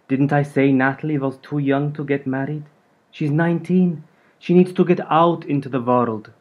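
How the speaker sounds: a hint of a Hungarian grandmother, matter-of-fact; Hungarian accent. A narrator's reading. Hungarian gran lecturing mum about Natalie, lightly accented. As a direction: lay it down with a light Hungarian lilt. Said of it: "held on to her accent".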